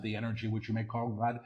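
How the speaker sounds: quick, soft undertone; hushed preview. Real, not acted. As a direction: say it softly and rapidly before proclaiming it. Quick soft take before repeating aloud.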